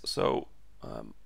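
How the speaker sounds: a tired, worn-out voice breaking down; voice giving out. Real, not acted. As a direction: voice cracking low and failing mid-word. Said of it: "my voice is going today".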